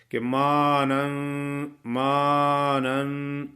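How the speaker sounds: مانن drawn out slowly with the alif held one count; teacher reciting مانن, stretching the alif one beat; slight alif-length madd. Shown as a demonstration. Teacher to student: مانن recited slowly with the alif held. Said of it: "تھوڑا سا اشارہ کرنا پڑتا ہے ایک الف کے برابر".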